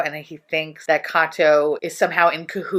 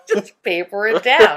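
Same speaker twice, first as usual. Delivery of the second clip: laughing; 7 semitones higher, 7 dB louder, slower than usual. Real, not acted. Repeating line, laughing.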